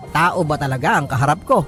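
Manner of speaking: ordinary delivery, nothing marked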